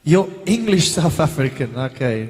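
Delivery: put-on South African accent, playful. Actor asking questioner about family's hometown, mock South African accent. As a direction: imitate a South African local.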